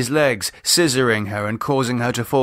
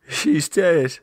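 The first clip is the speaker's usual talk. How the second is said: grieving; 7 semitones higher than usual. Acted. David grieving.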